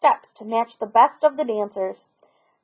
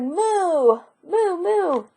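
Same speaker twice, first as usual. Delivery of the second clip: higher mooing; cow moo; 7 semitones higher, much slower than usual. A narrator's reading. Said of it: "Cow answered".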